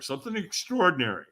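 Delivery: usual speaking voice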